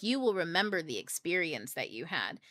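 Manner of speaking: ordinary talk, nothing marked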